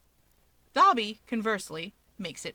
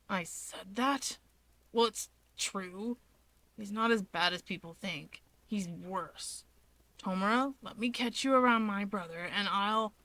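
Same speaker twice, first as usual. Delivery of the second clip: Dabi, dry and menacing; 5 dB quieter than usual. Acted.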